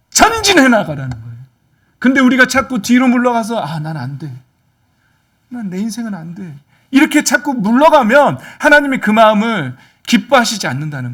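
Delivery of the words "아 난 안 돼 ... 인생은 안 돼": soft, defeated self-talk; hushed mock despair. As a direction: say it small, as if giving up. Acting out a defeated believer.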